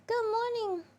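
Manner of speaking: squeaky little creature voice; high character voice. Pretend. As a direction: voice a cute pet character. Answers for Bernice, voice character.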